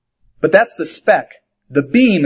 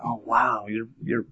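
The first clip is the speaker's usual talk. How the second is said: low, soft, halting reaction; quietly taken aback; 5 semitones lower, 11 dB quieter, slower than usual. Acted. Pastors reacting to a friend's confessed sins, quietly taken aback. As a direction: falter softly, lost for words.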